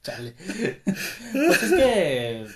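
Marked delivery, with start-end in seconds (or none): 1.3-2.5 high slow words then laughter; laughing. Real, not acted. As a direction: try to speak, then burst. Laughing fit.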